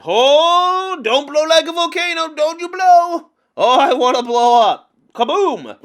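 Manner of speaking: high, loud hammed-up fuming; mock volcanic frustration. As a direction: pretend to erupt like a volcano. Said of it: "that's where I'm like".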